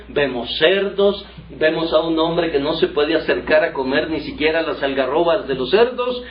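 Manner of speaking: usual speaking voice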